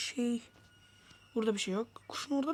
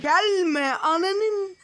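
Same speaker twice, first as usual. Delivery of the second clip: drawn-out yell; 5 semitones higher, 5 dB louder, much slower than usual. Real, not acted. Yelling at Granny.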